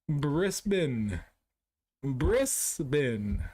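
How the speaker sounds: Brisbane drawn out as BRISS-BIN, hissed s; butchered pronunciation. Shown as a demonstration. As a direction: say Brisbane in two full syllables, BRISS-BIN. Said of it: "I am gonna butcher".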